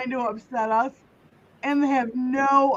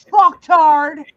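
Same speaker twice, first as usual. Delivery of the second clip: loud insult; 2 semitones higher, 10 dB louder than usual. Real, not acted. Insulting the chat troll.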